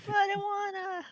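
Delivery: put-on high, whining childish plea; whiny man child. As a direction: whine like a kid denied a toy. Player whines about touching rocks.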